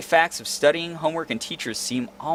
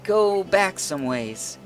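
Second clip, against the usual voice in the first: put-on polite young lady voice; 5 semitones higher than usual. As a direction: ease into a reluctant apology. Polite girl character, apologetic.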